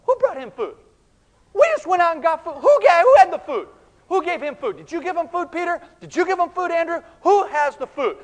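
high, loud, rapid-fire questioning; agitated bickering. Acted. Disciples turn on each other over food, agitated bickering. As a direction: fire off baffled, accusing questions.

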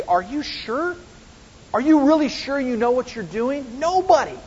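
high-pitched incredulous questioning; amazed doubting officers. Acted. Officers questioning the Sanhedrin's plan, incredulous. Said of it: "these officers of the Sanhedrin say".

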